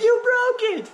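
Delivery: imitated kid's scream. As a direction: imitate the little boy screaming next door. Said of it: "So he screams, like I said, the top of his lungs".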